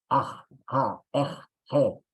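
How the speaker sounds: Aj, ja, ej, je spoken as separate throaty syllables; drilling sounds. Shown as a demonstration. Teacher drills sounds for home practice, throaty and clipped. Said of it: "sobre todo repitiendo este".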